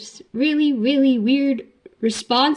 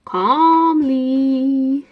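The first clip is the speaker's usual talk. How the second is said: "calmly" stretched out long in a raised voice; hammed-up "calmly"; 3 semitones higher, 4 dB louder than usual. Fan joking about the headmaster's question, hammed-up drawn-out "calmly". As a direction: draw "calmly" right out, anything but calm. Joke: hammed-up word contradicting calm.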